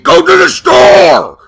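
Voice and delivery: loud, slow barked order; angry shout. Shown as a demonstration. Reading a curt text, a slow angry shout. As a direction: yell it like an angry text in caps. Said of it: "you might be thinking I'm saying".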